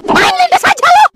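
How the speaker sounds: high-pitched outraged complaint; indignant yell. Acted. Run-over victim scolding whoever did it, indignant yell.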